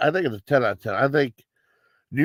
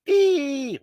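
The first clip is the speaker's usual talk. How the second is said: a sudden, much higher squeaky "Ee!"; high-pitched squeal; 13 semitones higher than usual. Retold or imitated. Imitating the annoying girl at the nightclub with a high squeal. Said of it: "she had a real high-pitched voice".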